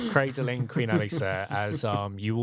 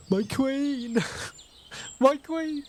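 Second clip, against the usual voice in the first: high, anguished cry for the queen; gasp, then cry out in distress; much higher, distraught voice; acted anguish; much slower than usual. Acted grief over fallen queen.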